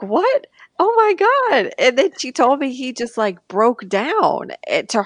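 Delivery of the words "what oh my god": high, startled gasp of disbelief; shocked disbelief. Retold or imitated. Retelling her shock at grandma crying, high stunned disbelief. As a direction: caught off guard, voice jumps up high. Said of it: "And I was like".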